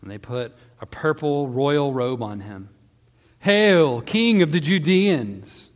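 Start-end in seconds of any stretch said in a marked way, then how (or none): 3.4-5.7 loud, high, drawn-out mock salute; mocking hail. A narrator's reading. Soldiers mocking Jesus in his crown, loud jeering salute. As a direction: hail him loudly in mockery. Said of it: "they mocked".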